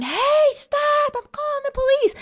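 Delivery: high, shrill shout; retold yell. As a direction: yell after the fleeing stranger. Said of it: "she's at the front door yelling".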